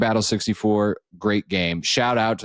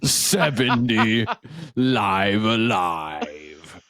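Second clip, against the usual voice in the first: slow, drawn-out gala announcer; much slower than usual. Acted. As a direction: announce each title like a trophy. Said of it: "He's got that announcer voice".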